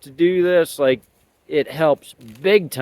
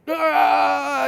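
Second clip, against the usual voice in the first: excited yelp; 12 semitones higher than usual. Retold or imitated. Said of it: "gets 'em excited, anxious, just".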